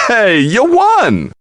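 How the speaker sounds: high, slow, stretched cheer; high drawn-out congratulations; excited cheer. Acted. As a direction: hail the winner with delight. Cheering the winner.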